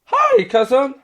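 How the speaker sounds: high, loud squeaky cheer; reading a cartoon pony's quote in her voice; Pinkie Pie. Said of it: "Pinkie Pie".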